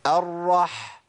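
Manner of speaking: "الرح" stopping on a long rasped h; teacher's model "الرح" with audible ح; firmly articulated ha. Shown as a demonstration. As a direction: press the h from mid-throat in "الرح". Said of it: "ولازم أمكن لمخرج الحرف".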